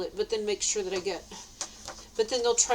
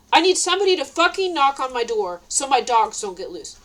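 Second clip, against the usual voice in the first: angry retold demand, louder; exasperated and forceful, louder on the swearing; mad; 3 semitones higher, 5 dB louder than usual. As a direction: snap out the cursing demand, retelling it. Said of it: "God inspired me to get mad".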